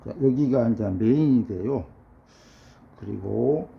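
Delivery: usual speaking voice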